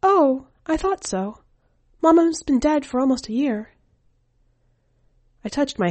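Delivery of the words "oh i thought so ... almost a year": a hint of a small boy speaking plainly of his dead mother; young boy. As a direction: read the boy's line higher, like a child. Boy answers with a chuckle.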